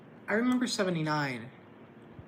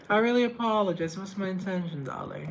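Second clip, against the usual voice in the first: higher, louder diva apology; Jennifer Coolidge mode; 6 semitones higher, 7 dB louder than usual. Retold or imitated. Vlogger apologizing to viewers in Coolidge's higher voice. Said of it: "stuck in Jennifer Coolidge mode".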